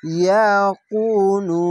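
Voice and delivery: ইয়াকুনু with the long কু held, very slow; drawn-out recitation. Shown as a demonstration. Teacher reciting ইয়াকুনু slowly for students. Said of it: "এক আলিফ টেনে পড়বেন".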